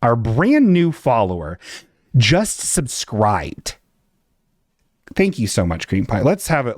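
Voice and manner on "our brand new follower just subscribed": hype announcer. Acted. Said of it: "let's have a fun sound effect".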